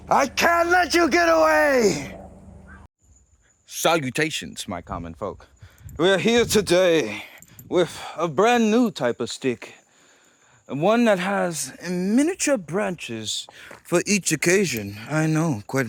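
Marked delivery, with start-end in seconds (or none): 0.1-2.2 high slow shout, far off; shouted hero-villain line. Pretend. Acted villain-style line. 3.7-15.0 pompous announcer voice. Pretend. Posh announcer voice.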